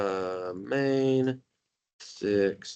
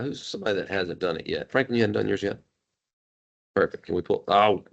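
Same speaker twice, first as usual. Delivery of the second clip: ordinary talk, nothing marked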